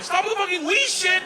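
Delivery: high-pitched, loud outburst; comic panicked protest, pitch way up; acted exasperated yell. Acts own panicked plea to Igor.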